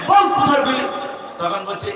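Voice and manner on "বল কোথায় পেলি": loud, high rebuke; scolding. Retold or imitated. Preacher scolding the boy for the prayer source. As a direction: bark the question at him. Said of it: "তা ধমক যখন মেরেছি".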